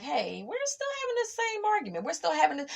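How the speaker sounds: high, fed-up sing-song voice; exasperated mock complaint. To a friend stuck in the same argument, mock exasperation. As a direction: act out the same old tired argument. Retold weary repeat argument.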